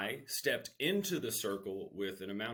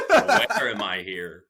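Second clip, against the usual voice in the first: laughing confusion; 13 dB louder, slower than usual. Retold or imitated. Waking in strange dorm.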